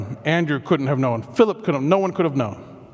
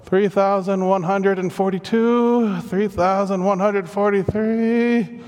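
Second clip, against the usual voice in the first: slow, drawn-out weary count; exhausted counting; 3 semitones higher, slower than usual. Acted. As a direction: count on, flat out on the floor. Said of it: "flat on the floor, like".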